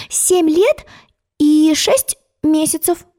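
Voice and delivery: high, slow, precise reply; a girl giving her exact age; careful child answer. Acted. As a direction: count it out proudly.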